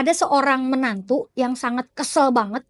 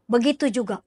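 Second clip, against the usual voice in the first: ordinary talk, nothing marked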